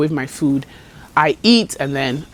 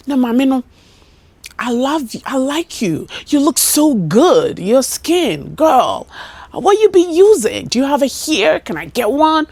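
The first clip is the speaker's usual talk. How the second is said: higher, bubbly, fawning customer; gushing compliments; 7 semitones higher, 3 dB louder than usual. Retold or imitated. Customer mimicked fawning over her skin, higher. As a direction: pile on the flattery.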